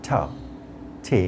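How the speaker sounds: "ঠা", "ঠে" with a light, airy T; light aspirated T. Shown as a demonstration. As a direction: let breath soften the T in "ঠা" and "ঠে". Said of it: "উচ্চারণ করতে হবে একটু হালকাভাবে". ("ঠা", "ঠে" হালকাভাবে, হাওয়া ছেড়ে)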